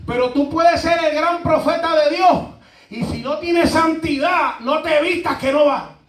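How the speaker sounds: loud, high preaching with a laugh; preaching at full force. Real, not acted. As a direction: drive the warning home. Preaching climax. (predicando fuerte con risa)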